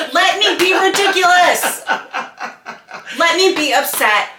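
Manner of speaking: high, loud, laughing plea; giggling exasperated demand; laughing rant. Real, not acted. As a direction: laugh it out while demanding space. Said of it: "venting with a laugh".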